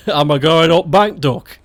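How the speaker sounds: broad Stoke, "bank" rising; thick Potteries dialect question; Stoke accent. Shown as a demonstration. Repeating the Stoke phrase in full accent. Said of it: "It's fucking nasty".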